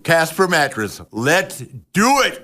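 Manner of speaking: ordinary delivery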